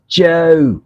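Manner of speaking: high British "Joe" with falling, inflected ending; British accent. Shown as a demonstration. Showing viewers Rob's inflection on Joe, British accent. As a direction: exaggerate the English inflection on the end of Joe. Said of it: "the inflex on the end".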